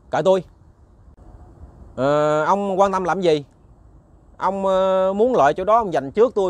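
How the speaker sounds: put-on cocky and rude, pushing the old man off; rude retort. Young man rudely rebuffs elder. (giọng hỗn hào, gắt gỏng)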